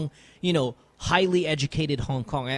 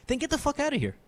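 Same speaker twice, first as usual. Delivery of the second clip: dismissive retort; 9 semitones higher, faster than usual. Retold or imitated. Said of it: "My response each and every time".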